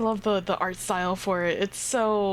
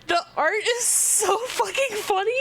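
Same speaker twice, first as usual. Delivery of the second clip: laughing excitedly; 12 semitones higher, 3 dB louder than usual. Real, not acted. Reacting to funny art.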